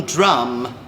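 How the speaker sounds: usual speaking voice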